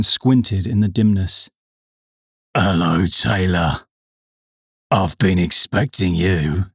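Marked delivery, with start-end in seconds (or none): 2.6-6.7 low, gruff villain voice. A narrator's reading. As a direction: growl it out low and slow. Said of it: "said a gruff voice".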